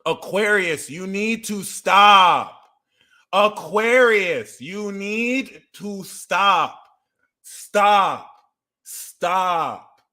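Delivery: high, loud and slow; drawn-out scolding. Shown as a demonstration. Scolding Aquarius, slow and loud. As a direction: stretch each word out like a scolding. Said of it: "repeated, louder and slower".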